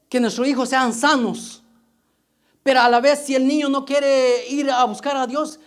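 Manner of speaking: ordinary delivery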